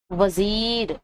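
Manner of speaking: وزیر with the ی stretched into a long "ee"; drawn-out vowel. Shown as a demonstration. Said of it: "کھینچ رہے ہیں کہ نہیں". (وزیر کو کھینچ کر)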